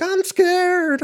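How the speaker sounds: hammed-up high, cowering voice; mock scared. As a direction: play a terrified kid. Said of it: "I'm like".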